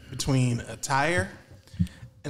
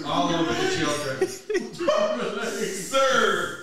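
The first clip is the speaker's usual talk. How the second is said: laughing; 7 semitones higher, slower than usual. Real, not acted. Laughing add-on to dirty joke.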